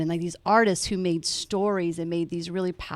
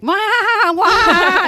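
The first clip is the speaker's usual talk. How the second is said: much higher, louder, wordless gushing wail; mock gushing; 14 semitones higher, 11 dB louder than usual. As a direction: squeal it like an overexcited fan's text. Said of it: "long text that's basically like".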